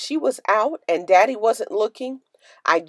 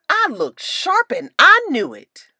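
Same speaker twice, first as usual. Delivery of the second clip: proud boast; 7 semitones higher, 4 dB louder than usual. A narrator's reading. Hat lady boasting proudly.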